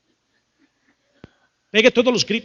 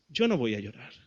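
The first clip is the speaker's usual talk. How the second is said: low, soft, held-in resolve; acting a hardened woman, much lower and softer; 8 semitones lower, 10 dB quieter than usual. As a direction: hold back tears with a hard, quiet refusal. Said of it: "hay mujeres que se endurecen y dicen".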